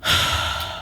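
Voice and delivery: heavy breathy sigh; long exhale before answering. Acted. Exasperated start before answering.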